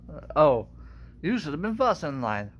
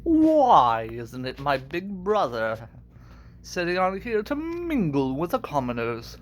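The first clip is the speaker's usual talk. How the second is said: Scar. Acted. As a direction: greet a brother with mock grandeur. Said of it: "I can kinda do Scar".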